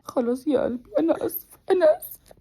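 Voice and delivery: tearful, pitch climbing high; breaking into tears, apologizing to viewers; crying apology. Real, not acted. A mother apologizes to her followers, crying.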